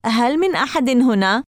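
tentative call to see if anyone's there; slightly stronger call. A narrator's reading. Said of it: "بصوت أقوى قليلًا".

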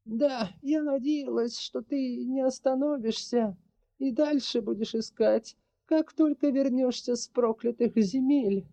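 put-on high, measured voice of an old witch. A narrator's reading. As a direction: voice the sorceress, calm and heavy.

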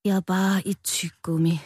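whispered, dejected confession; Rød confessing to the strawberry; sad whisper. Acted. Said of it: "Rød svarede bedrøvet".

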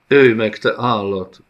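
loud desperate cry at the beast; sobbing scream. A narrator's reading. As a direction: scream through tears. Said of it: "Üvöltötte zokogva". (zokogva üvöltő hercegnő)

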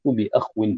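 بأخو said bi-akh-win; no vowel after the خ in بأخو, then -win; silent-vowel kha, then waw. Shown as a demonstration. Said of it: "بإسكان الخاء".

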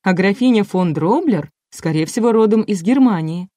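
ordinary delivery

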